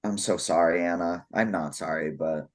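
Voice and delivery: low, soft, deadpan shade. Real, not acted. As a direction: drop it flat and low. Deadpan mock apology to Anna.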